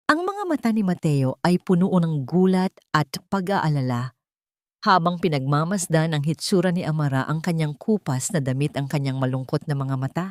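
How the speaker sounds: plain reading voice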